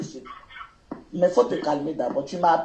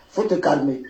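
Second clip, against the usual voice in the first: usual speaking voice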